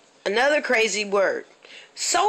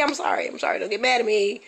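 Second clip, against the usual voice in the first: normal talking voice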